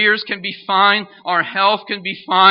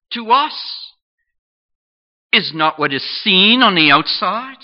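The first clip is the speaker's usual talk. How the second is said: forceful preaching; 2 semitones higher, 7 dB louder than usual. Acted. Sermon climax.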